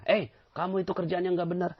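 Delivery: scolding boss. Retold or imitated. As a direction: bark it out like an annoyed boss. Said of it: "sang bosnya marah".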